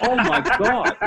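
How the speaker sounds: high, laughing "oh my God"; laughing exclamation. Real, not acted. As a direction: burst out laughing as you say it. Laughing at accent banter.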